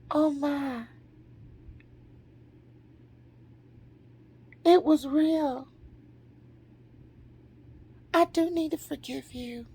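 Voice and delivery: high, soft, awed realization; inner little girl. Retold or imitated. Little girl in her feels validated by mother, soft awe. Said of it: "That little girl in me went".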